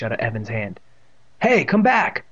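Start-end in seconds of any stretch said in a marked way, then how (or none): 1.4-2.2 high, raised call. A narrator's reading. Boy calls after runaway dog, calling out. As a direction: call after someone running off. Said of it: "Evan called".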